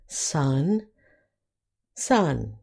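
Sun and sun both said identically with a short "uh"; short vowel. Shown as a demonstration. Teacher showing two spellings share one sound, careful modelling. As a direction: say Sun, sun separately, same "uh" vowel. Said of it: "Both are short".

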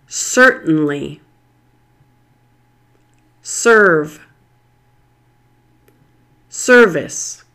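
each word said alone and carefully, stress on the er syllable; teacher reading a word list aloud, crisp citation form. Shown as a demonstration. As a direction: model each word clearly for learners to repeat. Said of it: "me as I read these common er words".

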